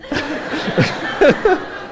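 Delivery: breathless high laugh; real laugh at the punchline; airy laugh. Real, not acted. Laughing at his daughter's answer.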